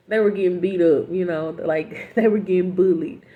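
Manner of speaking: giggling through the words; laughing. Real, not acted. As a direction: laugh while telling it, loud bursts. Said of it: "I'm not laughing because".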